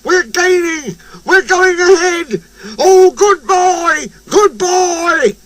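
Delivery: very high, thrilled cheering, drawn out at the end; excited shout. A narrator's reading. Driver cheers Percy in the race, very high excited shout. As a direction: urge him on, voice pitched right up. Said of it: "he shouted".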